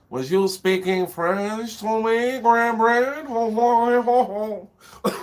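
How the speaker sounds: high, slow, hammed-up jeering voice, ending in a mock ho ho ho; mock teasing voice. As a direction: play a cheeky pantomime tease, dragging it out. Mock voice teasing co-host about French.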